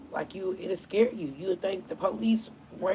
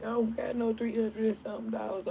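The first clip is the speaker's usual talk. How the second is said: imitating crying lady; 6 semitones higher than usual. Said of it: "Had the little lady out there crying".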